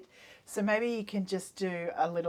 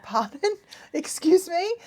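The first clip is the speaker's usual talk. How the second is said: baffled pardon; 10 semitones higher, 5 dB louder than usual. Retold or imitated. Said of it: "I was like".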